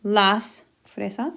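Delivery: Las fresas, said slowly and clearly; slow model. Shown as a demonstration. Teacher modelling the answer to students, slow and clear. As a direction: spell it out slowly for the class. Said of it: "I'm just gonna put las".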